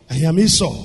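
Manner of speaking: I am Esau, dropped low and soft; soft repeat. Shown as a demonstration. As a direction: let the name Esau fall quiet.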